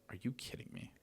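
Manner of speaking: breathless whispered are you kidding me; a whispered, stunned complaint; disbelieving whisper. Retold or imitated. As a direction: hiss it under your breath in disbelief. Retelling reaction to wrong file.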